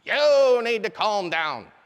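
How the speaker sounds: loud, drawn-out military command; drill instructor voice. Shown as a demonstration. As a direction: bellow it slowly like a drill instructor. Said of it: "get out my drill instructor voice".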